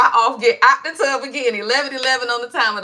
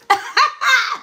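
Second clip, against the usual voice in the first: a sudden high laugh; high cackle; 12 semitones higher than usual. Real, not acted. Laughing at her own joke to viewers with a high cackle. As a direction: crack up at your own joke.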